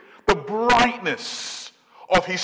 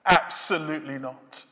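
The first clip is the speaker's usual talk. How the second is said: ordinary talk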